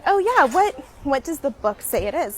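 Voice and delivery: high, loud mock skeptical question; challenging mansplainer. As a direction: imitate a doubting date. Imitating her mansplaining date.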